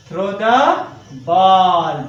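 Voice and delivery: teacher stretching words for copying; slow dictation. Shown as a demonstration. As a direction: dictate it at half speed. Dictating sentence while writing it.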